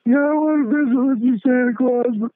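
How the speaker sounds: put-on very high, slow, tearful child; crying kid voice. The boy explaining his hot lunch to Santa, high teary whine. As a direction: whimper it like a little boy. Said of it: "he looked up at me and he says".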